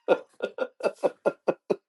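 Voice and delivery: long, high-pitched staccato laugh; high, rattling chuckle; high giggle. Real, not acted. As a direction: giggle helplessly at your own joke. Laughs at his own joke.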